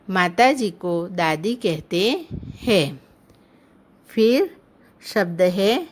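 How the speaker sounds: ordinary talk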